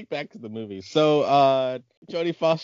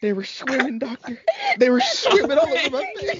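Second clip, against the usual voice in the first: very high hammed-up country accent, building louder; 13 semitones higher, 6 dB louder than usual. Parodying the trainee's confession to the doctor, wailing country accent. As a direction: wail it in a mock country accent. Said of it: "she had a country accent".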